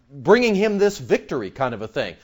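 usual speaking voice

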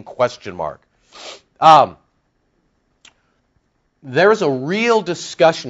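ordinary delivery

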